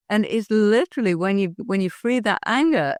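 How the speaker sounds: usual speaking voice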